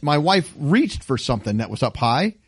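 usual speaking voice

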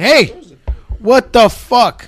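loud, high, slow Yonkers demand; Yonkers accent. Acted. Man showing how he gets things done at home, Yonkers accent. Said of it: "when I come with my Yonkers".

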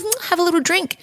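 sing-song mum voice. Retold or imitated. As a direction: coax a baby to sip. Imitating offering toddler a drink.

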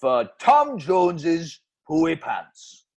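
slow Welsh ad sign-off; Welsh accent. Acted. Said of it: "Bloody Welsh accent again".

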